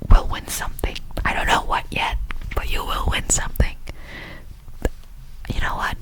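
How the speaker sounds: normal talking voice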